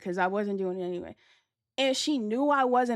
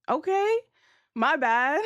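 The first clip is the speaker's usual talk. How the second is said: much higher, hammed-up sorry; mock apology; 6 semitones higher, 6 dB louder, slower than usual. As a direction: play it like a fake-innocent oops. Caught filming, mock apology.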